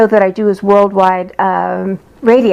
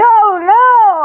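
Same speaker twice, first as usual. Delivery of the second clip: hammed-up squeal of dismay; high mock wail; 12 semitones higher than usual. Client hearing he'll marry, a high mock wail. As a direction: squeal it high like a heartbroken girl.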